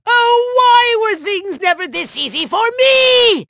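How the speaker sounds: high, wailing self-pity; hammed-up villain whine; theatrical lament. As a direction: wail about how unfair it is. Villain bemoans his bad luck.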